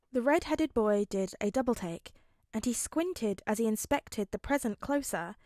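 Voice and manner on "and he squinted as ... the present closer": ordinary delivery, nothing marked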